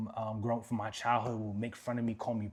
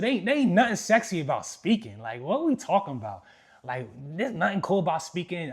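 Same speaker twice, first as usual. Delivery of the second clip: protesting; 6 semitones higher, 7 dB louder than usual. Retold or imitated. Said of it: "I said".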